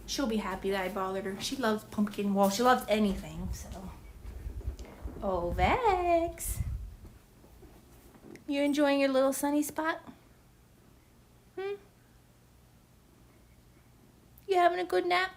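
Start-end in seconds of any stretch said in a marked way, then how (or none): 5.2-15.3 pet talk. Real, not acted. Talking to her dog.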